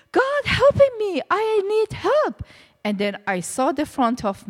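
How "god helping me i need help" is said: high, loud cry for help; desperate plea. Retold or imitated. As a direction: beg God from the pain of the nails. Begging God while crucified.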